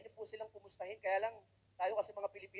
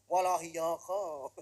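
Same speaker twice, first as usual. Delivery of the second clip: slow, loud, heavy mock Japanese delivery; mock Japanese retort; 9 dB louder, much slower than usual. Seaman retorts to a Japanese captain, slow loud mock Japanese. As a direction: drag it out like a gruff captain. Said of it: "ginaganon ko na lang".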